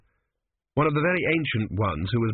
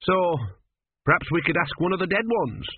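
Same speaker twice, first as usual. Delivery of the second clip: higher, lad's suggesting voice; young apprentice; 6 semitones higher than usual. A narrator's reading. Apprentice suggests asking the dead, in a higher voice. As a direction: offer it in a high apprentice voice. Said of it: "said Gurn".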